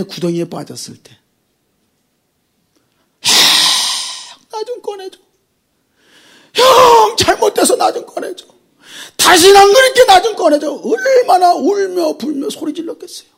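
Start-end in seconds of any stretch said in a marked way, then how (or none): 3.2-10.7 high, crying plea to his brothers; Joseph pleading from the pit. Acted. Joseph in the pit crying to his brothers for rescue, a tearful high plea. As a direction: beg them tearfully to pull you out.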